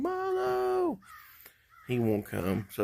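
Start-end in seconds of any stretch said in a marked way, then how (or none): 0.0-1.0 much higher, long drawn-out call; calling for the dog. Retold or imitated. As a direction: call a lost dog across the yard. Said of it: "So I'm out here yelling for him".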